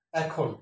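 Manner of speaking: এখন as 'æk-hon', open first vowel; correct pronunciation. Shown as a demonstration. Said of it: "সঠিক উচ্চারণ হচ্ছে". (এখন, বিবৃত অ্যা দিয়ে)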